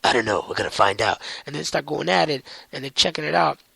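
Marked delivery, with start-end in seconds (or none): 0.0-1.2 much lower, steady gruff; low brooding vigilante; Batman voice. Retold or imitated. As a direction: say it low and grim. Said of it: "Batman's like".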